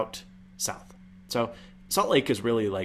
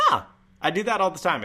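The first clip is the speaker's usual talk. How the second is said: higher voice with a crack at the start; cracked, raised-pitch objection; intentional voice crack; 7 semitones higher, 4 dB louder than usual. Shown as a demonstration. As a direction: protest with the voice jumping up. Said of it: "It's not a voice crack. It's intentional".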